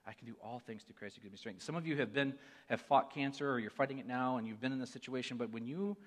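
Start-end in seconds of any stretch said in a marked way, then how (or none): 0.0-1.6 softer, inward recital of the verse; reciting in his head. Retold or imitated. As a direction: say it to yourself like a silent prayer. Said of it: "I had to say that verse over and over in my head".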